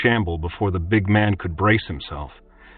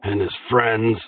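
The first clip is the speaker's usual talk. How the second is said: slow resentful man's voice; lightly voiced sullen prisoner; sullen captive. A narrator's reading. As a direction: answer grudgingly under the blade.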